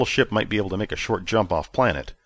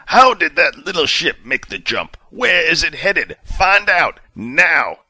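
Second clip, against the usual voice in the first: louder, higher furious demands; angry commander; 9 semitones higher, 7 dB louder, slower than usual. A narrator's reading. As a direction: bear down on the crew.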